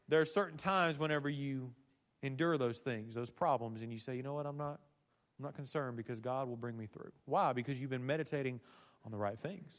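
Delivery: very soft, low, calm confiding; hushed reassurance. Acted. Preacher voicing trust that God will bring him through, hushed. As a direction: drop right down to a quiet, settled assurance.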